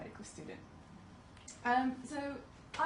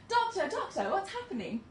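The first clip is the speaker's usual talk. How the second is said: much higher, pleading "Doctor, doctor"; raised, fretful patient voice; mimicked anxious patient; 11 semitones higher, 4 dB louder than usual. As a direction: play the worried patient calling out.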